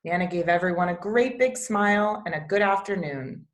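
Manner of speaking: ordinary delivery, nothing marked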